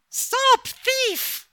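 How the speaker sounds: high, loud cry for help; screaming. A narrator's reading. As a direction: scream it out, high and shrill-free. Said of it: "the screaming voice had come from a woman".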